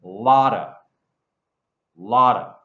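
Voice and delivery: Lotta with a d-like flap; Lotta, flapped t, of reduced to a; lotta demo. Shown as a demonstration. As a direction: say Lotta, lot of as one word. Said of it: "it sounds like a D".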